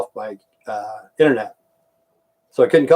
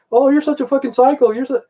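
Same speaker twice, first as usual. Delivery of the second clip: high mocking voice, much higher; mocking impression; 12 semitones higher than usual. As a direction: sneer it like the people who call him a psycho. Mocking what others call him.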